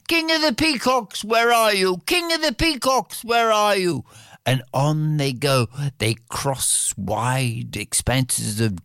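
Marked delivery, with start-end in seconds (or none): 0.1-4.0 high, ringing call across the land. Acted. Princes calling far and wide for the peacock king, high calling.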